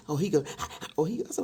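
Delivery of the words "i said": normal talking voice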